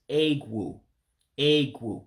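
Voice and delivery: "egwu" with the E said like A, "ay-gwu"; "Egwu" as "ay-gwoo"; Igbo word egwu. Shown as a demonstration. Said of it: "the E makes an A sound".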